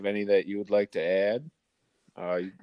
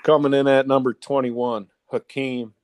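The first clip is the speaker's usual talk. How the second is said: top ten countdown; 5 semitones higher, 6 dB louder than usual. Acted. Announcing nickname countdown list.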